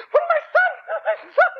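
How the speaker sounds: very high hysterical mother's wail; screaming. Acted. Mother horrified at what her son did, screaming. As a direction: shriek it in disbelief, hammed up. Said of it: "She starts screaming".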